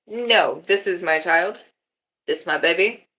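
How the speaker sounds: normal talking voice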